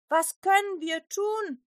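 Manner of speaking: high, slow, contrite asking; guilt-ridden question. A narrator's reading. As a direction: ask as if ashamed. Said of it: "fragten die Menschen schuldbewusst".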